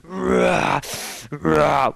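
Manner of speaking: loud fake roar; growling roar. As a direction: roar like a tiger. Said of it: "start growling and making a whole bunch of noise".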